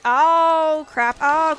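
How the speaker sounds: loud, high cry of dismay; dismayed outburst. Real, not acted. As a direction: blurt it out loudly at a sudden loss. Suddenly loses something in the game.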